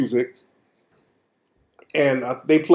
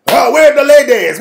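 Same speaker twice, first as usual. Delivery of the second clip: mock DJ hype; 8 semitones higher, 4 dB louder than usual. DJ on the mic.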